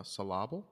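Syllable as suh-LAH-bul; "suh-LAH-bull" for Syllable, rising at the end; corrective mispronunciation. Shown as a demonstration. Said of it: "No, no, no".

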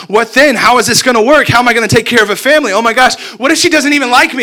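from worried to frantic; rising panic. Retold or imitated. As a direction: let the anxiety run away with you. I began to get worried.

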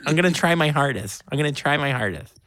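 high-pitched, mock-earnest pledge; retold eager promise. Answering the stripper's friend, high mock-earnest promise.

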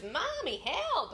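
put-on high childlike cry for help; child calling for help. Daughter calling from the basement, high and urgent. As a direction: call down like a scared little girl. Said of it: "And she goes".

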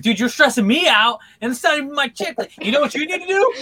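high, loud, stressed-out retelling; hammed-up frantic outburst; mock panic. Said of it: "he's all doing it in a panic".

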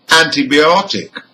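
"Antibiotic", secondary stress on AN, primary on OT. Shown as a demonstration. Teacher repeats the word for learners, "Antibiotic" stressed on OT. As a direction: lean lightly on AN, then hit OT in "Antibiotic". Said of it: "this time the prefix is an".

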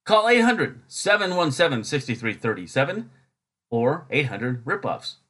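high, slow hard-sell announcer; mock commercial pitch with drawn-out key words; parody ad read. Parody advertisement phone number.

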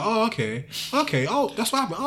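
high, soft string of okays; light, high-pitched imitation of mum hearing excuses; mock acceptance. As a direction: play along as the unconvinced mum. Mum's reaction to the excuse acted out.

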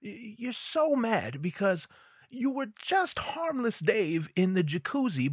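ordinary talk, nothing marked

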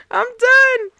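laughing. Real, not acted. Still laughing.

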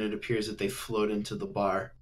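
ordinary talk, nothing marked

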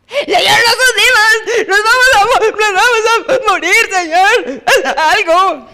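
high, loud, crying panic; panicked cry. Acted. Disciples crying to Jesus as the boat sinks, panicked. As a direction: wail for help as the boat sinks.

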